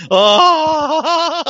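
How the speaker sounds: high mock moan with a laugh; a hammed-up blissful "Ah"; imitated ecstatic gasp. Imitating the wrestler's ecstatic grin sound, high and laughing. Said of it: "orgasmic smile, and he's like".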